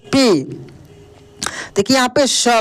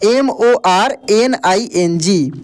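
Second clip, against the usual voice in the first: letter by letter, slow and clear; slow, deliberate spelling of each letter; 4 semitones lower, much slower than usual. Shown as a demonstration. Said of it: "देखिए".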